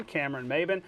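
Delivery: usual speaking voice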